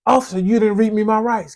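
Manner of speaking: lightly voiced indignant arrestee; mock complaining suspect. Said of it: "everybody always say".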